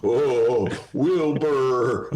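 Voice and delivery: neigh-laugh like the talking horse; loud whinnying laugh calling Wilbur; Mister Ed laugh. Acted. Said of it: "That's my Mister Ed imitation".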